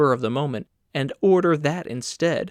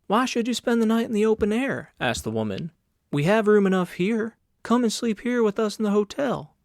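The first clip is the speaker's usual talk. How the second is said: a hint of a higher, coaxing woman's voice; lightly voiced woman; 6 semitones higher than usual. A narrator's reading. Landlady urging Carl to stay, higher and coaxing. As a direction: coax him gently, a bit higher.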